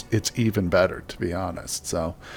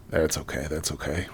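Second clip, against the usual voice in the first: softly reassuring; 7 semitones lower, 10 dB quieter than usual. Real, not acted. Soothing after fighter gets hit.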